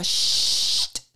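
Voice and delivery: loud hissing shush; sharp hush. Acted. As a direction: silence her instantly. Venus cuts Psyche off.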